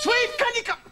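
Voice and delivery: high-pitched summons, cut short. Acted. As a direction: call out too sharply, forgetting yourself. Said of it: "wrong to raise my voice".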